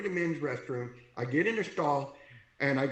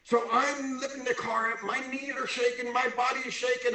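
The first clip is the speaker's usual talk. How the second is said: acted strain; 8 semitones higher than usual. Acting out the strain of lifting.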